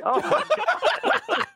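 high, drawn-out laughing exclamation; amused disbelief. Retold or imitated. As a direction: break up laughing in retold disbelief. Retelling reaction to cartoon joke.

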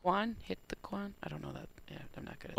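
half-voiced, murmured under the breath; muttered aside. Real, not acted. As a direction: mumble it to yourself, almost unvoiced. Mumbling dance song aside.

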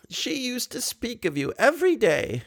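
put-on high, fond old lady voice; tender grandmotherly coaxing. A narrator's reading. Old lady calls Raoul near like a boy, high.

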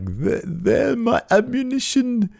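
very high, slow, drawn-out voice; Eddie Dennis. Acted. Pack leader rattling off nonsense, high drawn-out mock voice. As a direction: stretch each word out in a high put-on voice. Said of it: "Eddie Dennis says something like".

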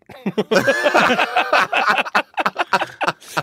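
big high laugh over a mic; booming laugh. Retold or imitated. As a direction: let out a huge booming laugh.

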